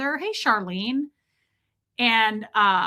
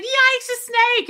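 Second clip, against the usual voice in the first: very high, loud, slow squeal; mock-terrified shriek; re-enacted alarm; 6 dB louder, slower than usual. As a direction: stretch it into a comic scream. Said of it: "I said".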